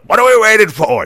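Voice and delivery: big high-pitched shout as a fired-up boxing trainer; Mickey impression. Trainer rallying his boxer once the wife says win, shouted. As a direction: yell it to rouse your fighter. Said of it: "And then Mickey goes".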